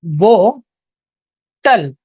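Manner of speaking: बोतल drawn out very slowly, syllable by syllable; slow demo. Shown as a demonstration. Teacher slowly sounds out bottle for the class. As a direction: stretch बोतल out slowly and evenly. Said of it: "अगर read करेंगे तो ऐसा आएगा".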